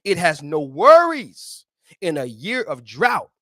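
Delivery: unhurried reverent reading of the verse; slow scripture reading. Acted. Preacher reading the verse to listeners, slow and reverent. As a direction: read it slow and savour it.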